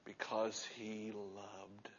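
slow, hushed whisper. Acted. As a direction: breathe the answer out slowly. Whispered answer to why.

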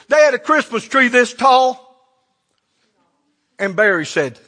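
usual speaking voice

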